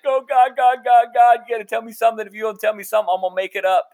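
high, loud begging, easing down gradually; mock panicked plea. Man prays inwardly for words to say, comic panicked plea. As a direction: plead urgently, playing up the panic. Acts out his panicked inner prayer.

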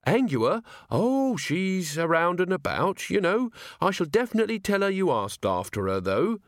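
lightly voiced earnest young guardsman; polite, eager young man. Carrot replying to waiter.

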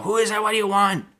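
high, snippy "who is that"; annoyed phone answer. Retold or imitated. Answering her boyfriend's call, high and irritated.

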